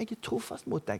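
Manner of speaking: myk, lys gjengivelse av hvisking; gjengitt hvisking. Retold or imitated. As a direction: gjengi det som et dempet hvisk. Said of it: "så bare hvisker han til meg". (dempet, gjengitt hvisking til predikanten)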